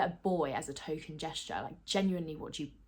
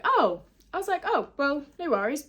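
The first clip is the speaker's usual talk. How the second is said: high deflated oh; 7 semitones higher, 5 dB louder than usual. Retold or imitated. Retold disappointed reaction.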